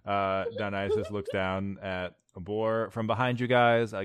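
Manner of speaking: ordinary talk, nothing marked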